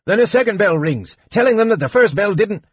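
high, fast gnome chatter. A narrator's reading. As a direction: rattle it off like an eager gnome.

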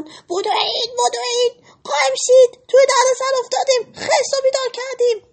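high, loud frantic cries; panicked shouting. Acted. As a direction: scream for everyone to run and hide. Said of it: "اونا با هم فریاد زدن".

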